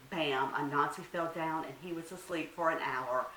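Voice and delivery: ordinary delivery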